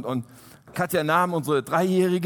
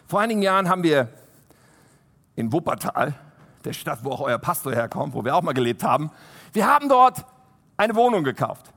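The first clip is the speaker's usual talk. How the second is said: ordinary talk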